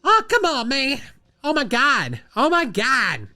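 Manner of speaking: high whining protest, stretched out; Cat. Acted. Host mimics Cat code switching, high-pitched complaint. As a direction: plead in a hammed-up high voice. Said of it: "I love it when Cat code switches".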